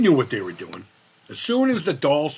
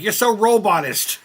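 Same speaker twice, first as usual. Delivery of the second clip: mock outraged; 10 semitones higher, 9 dB louder than usual. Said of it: "they're like".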